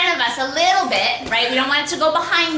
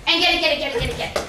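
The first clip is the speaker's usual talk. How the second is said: usual speaking voice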